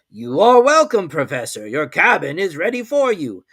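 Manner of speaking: loud, hearty captain's welcome; commander's welcome. A narrator's reading.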